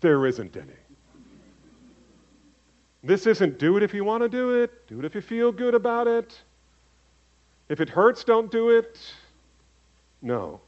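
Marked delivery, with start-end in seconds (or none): 3.0-9.0 mock suggestion. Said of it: "the great suggestion".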